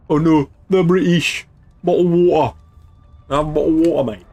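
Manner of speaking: exaggerated comic British accent; mock British accent. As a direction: send up a British accent, loudly. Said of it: "I was making fun of the British accent".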